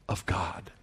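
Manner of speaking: sudden whispered aside; whispered reverently. Real, not acted. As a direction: drop to a hush on God. Hushed aside on God's people.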